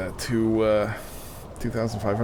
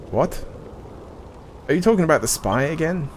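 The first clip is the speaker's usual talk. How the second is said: normal talking voice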